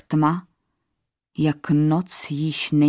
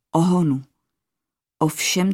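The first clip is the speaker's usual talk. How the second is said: ordinary delivery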